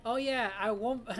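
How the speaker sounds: usual speaking voice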